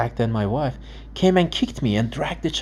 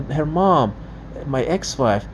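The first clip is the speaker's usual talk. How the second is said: ordinary talk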